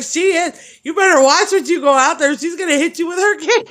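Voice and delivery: high, loud, laughing through the words; high, loud giggly warning about the cane. Retold or imitated. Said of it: "She's like".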